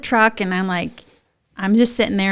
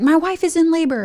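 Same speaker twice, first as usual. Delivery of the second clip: raised-pitch mock alarm; panicked announcement; 7 semitones higher than usual. Husband running into emergency room, panicked. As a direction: imitate a frantic husband.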